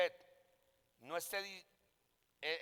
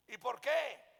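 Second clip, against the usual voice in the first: higher, insistent question; mock bewilderment, pitched up; retold puzzled demand; 6 semitones higher, 4 dB louder, slower than usual. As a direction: imitate someone baffled asking for a reason. Acts out pastor's puzzled demand.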